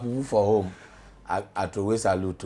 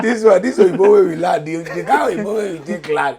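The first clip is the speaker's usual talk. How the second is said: laughing through the words; amused laugh, much louder; 8 semitones higher, 10 dB louder than usual. Real, not acted. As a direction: laugh as you begin. Laughing while starting to answer.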